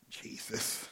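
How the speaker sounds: a whisper, as if sharing a secret; soft whispered one-word answer. Retold or imitated. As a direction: breathe "Jesus" as a hushed reverent reply.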